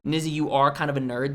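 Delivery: usual speaking voice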